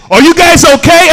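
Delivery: high, loud mimicked check-in. Truck driver checking on walkers, loud high mock concern. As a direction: call it out like a worried passerby. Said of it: "looks at me and says".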